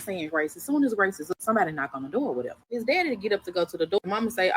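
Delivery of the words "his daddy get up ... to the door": ordinary delivery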